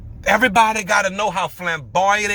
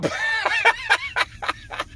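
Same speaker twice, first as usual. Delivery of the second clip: helpless high-pitched laughing fit; long wheezy cackle; real laugh; 5 dB quieter than usual. Real, not acted. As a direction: crack up at your own story. Cracking up telling story.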